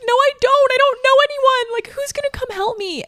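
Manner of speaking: whining in mock despair; mock panic. Retelling her own panic to her friend, hammed-up whine. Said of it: "And I'm like".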